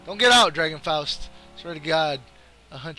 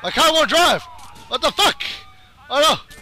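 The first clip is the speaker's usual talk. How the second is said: panicked yell; 6 semitones higher than usual. Real, not acted. Gamer whose car won't drive, panicked yell. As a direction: yell in frustrated alarm. Car fails mid-chase.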